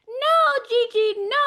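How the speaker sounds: put-on high, loud child's scream of refusal; toddler screaming no. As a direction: scream it like a furious three-year-old. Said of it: "really screaming at the top of her".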